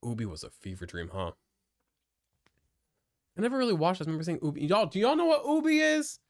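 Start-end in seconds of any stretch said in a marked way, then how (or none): 4.7-6.2 high, loud eager question; excited. Real, not acted. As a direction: ask chat with sudden excitement. Excited asking chat about Ubi.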